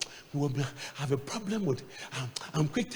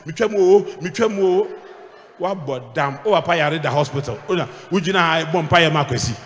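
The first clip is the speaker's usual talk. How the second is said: mock loud pleading; 9 dB louder than usual. Acted desperate prayer request.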